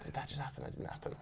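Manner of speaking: hushed, breathless disbelief, barely audible; scared whisper. Retold or imitated. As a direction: whisper it as if afraid to be heard. Stunned housemates whisper in fright.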